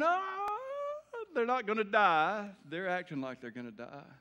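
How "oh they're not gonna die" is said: imitating a crying child, high wailing oh; mock child wail. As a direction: ham up a kid bawling over a spanking. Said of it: "And they're screaming".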